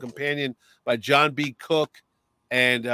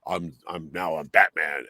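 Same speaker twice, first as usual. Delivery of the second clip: much lower, gruff Batman voice; 11 semitones lower than usual. Retold or imitated. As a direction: drop far down into the Batman voice. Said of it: "changed the voice".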